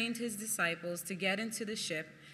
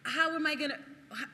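panic; 7 semitones higher, faster than usual. Retold or imitated. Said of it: "Peter starting to sink".

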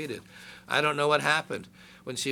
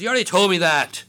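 mock impatient God; 5 semitones higher, 9 dB louder than usual. God voiced, impatient joke.

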